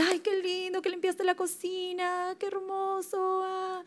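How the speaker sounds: high, doting mock praise from mom; praising mom. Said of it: "mi mami me dice". (imitando a la mamá que elogia con voz aguda y cariñosa)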